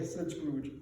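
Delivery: ordinary delivery, nothing marked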